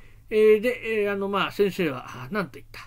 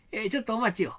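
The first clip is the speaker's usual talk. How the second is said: ordinary talk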